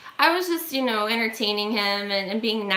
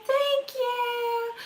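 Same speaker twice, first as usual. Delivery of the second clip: much higher, sung fake-polite thank you; sing-song thanks; 14 semitones higher, 4 dB quieter than usual. Woman brushing off the stranger, sing-song polite thanks. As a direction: trill it to dismiss him nicely. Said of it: "I'm like".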